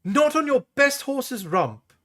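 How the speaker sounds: high tart snap; snapping. A narrator's reading. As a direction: cut him off, a princess not to be argued with. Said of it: "snapped Carline".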